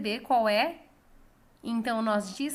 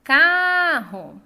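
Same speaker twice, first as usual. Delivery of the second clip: "Carro" called loudly, CAR high and long; calling out the word; 4 semitones higher, 10 dB louder than usual. Shown as a demonstration. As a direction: call out "Carro", holding CAR loud and high. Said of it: "é a sílaba que foi alongada".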